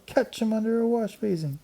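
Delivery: put-on high excited girl voice. A narrator's reading. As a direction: urge the others on. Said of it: "someone yelled".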